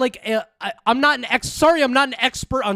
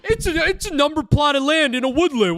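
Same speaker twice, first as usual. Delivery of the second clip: sneering sing-song repeat of his words, quicker and higher; mocking parody; 4 semitones higher, faster than usual. Mocking the YouTuber's claim with a sneering parody. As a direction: parrot him back with a laugh and scorn.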